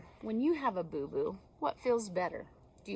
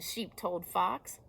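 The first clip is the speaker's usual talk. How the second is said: plain reading voice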